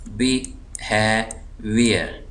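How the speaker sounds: Behaviour very slow, in separate syllables. Shown as a demonstration. Teacher dividing the word for students, slow split syllables. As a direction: break behaviour into बि, हे, wear with pauses. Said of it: "ज़ोर दे रहा हूँ".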